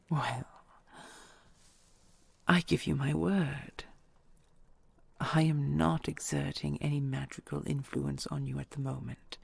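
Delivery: usual speaking voice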